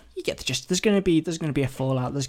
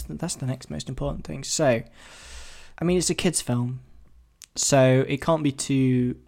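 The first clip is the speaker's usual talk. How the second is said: ordinary talk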